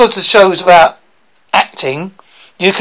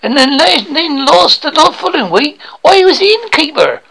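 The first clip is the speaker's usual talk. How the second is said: broad rural British bumpkin, higher voice; eager would-be actor; 7 semitones higher than usual. Acted. Aspiring actor boasts to coach about school play, eager bumpkin voice. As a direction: play a naive hopeful with a thick country accent.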